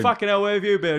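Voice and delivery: high, loud mock furious yell; angry yell. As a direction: bellow it at the runaway bird. Owner yelling at returned parrot.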